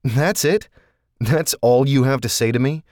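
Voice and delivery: put-on a man flaring up at his old lover; indignant outburst. A narrator's reading. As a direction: throw it back at him, hurt and angry. Shane angry at Norton's indifference.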